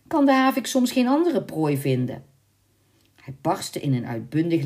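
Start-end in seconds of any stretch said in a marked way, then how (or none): 0.1-2.2 indignant retort. A narrator's reading. Girl snaps back at suitor.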